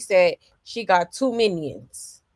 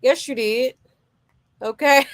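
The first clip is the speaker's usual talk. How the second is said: ordinary talk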